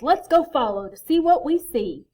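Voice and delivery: rally the friends to follow; eager hare. A narrator's reading. Hare's character voice.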